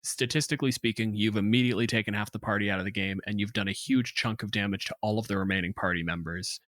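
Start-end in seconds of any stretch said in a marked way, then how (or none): none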